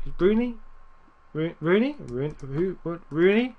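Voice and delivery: name repeated in rising, puzzled questions; incredulous, halting double-take; baffled questioning. Real, not acted. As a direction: puzzle aloud over an unfamiliar name, pitch jumping up. Puzzled by an unknown player name.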